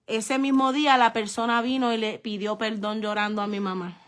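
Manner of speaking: ordinary talk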